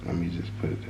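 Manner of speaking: soft whisper. Real, not acted. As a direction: whisper it, barely voiced. Whispering near the mic.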